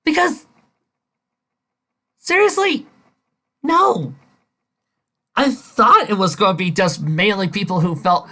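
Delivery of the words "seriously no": ordinary delivery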